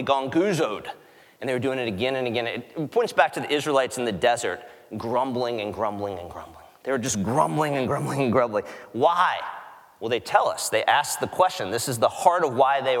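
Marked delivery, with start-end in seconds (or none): none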